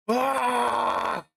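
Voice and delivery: high, drawn-out mock scream; long "aah" yell, put on. Shown as a demonstration. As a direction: demonstrate screaming into the mic. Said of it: "I'm just screaming into the microphone".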